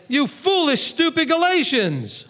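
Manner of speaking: high, slow exasperated rebuke; loud rebuke. Retold or imitated. Paul rebuking the Galatians, loud and exasperated. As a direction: scold them slowly from up high. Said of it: "a little loud".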